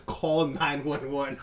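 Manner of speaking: slow, measured "Call nine one one"; deliberate, careful "Call nine one one"; careful perfect English. Retold or imitated. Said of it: "in damn perfect English".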